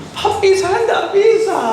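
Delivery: put-on high, slow whiny child's plea; child begging his father, whining; like a little child. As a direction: whimper it to Daddy like a small kid. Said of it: "kayak anak kecil tuh".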